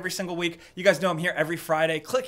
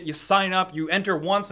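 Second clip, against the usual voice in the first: ordinary talk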